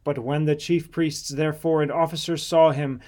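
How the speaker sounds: ordinary delivery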